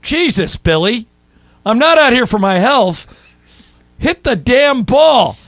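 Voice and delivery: hammed-up exasperated manager yelling at a hitter; imitating Lasorda's angry yell. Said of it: "And Lasorda says to him".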